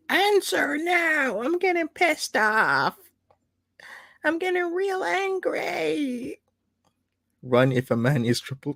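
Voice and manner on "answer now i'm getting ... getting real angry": high, slow, put-on angry guy voice; mock angry texter. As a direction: ham up a texter losing patience. Mocking desperate texter, angry.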